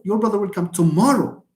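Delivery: "tomorrow" punched on its middle syllable; strong final emphasis on "tomorrow"; stress on TOMORROW. Shown as a demonstration. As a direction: save the weight for "tomorrow". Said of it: "And when you stress".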